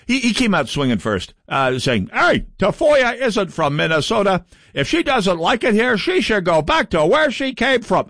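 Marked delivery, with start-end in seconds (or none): 2.1-8.1 a lightly voiced Matt Little, pitched up; a hint of a smug state senator, raised and whiny; Matt Little impression. State senator telling Tafoya to go home, lightly mocked in a higher voice. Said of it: "That's a very good Matt Little impression".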